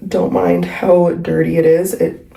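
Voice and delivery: ordinary talk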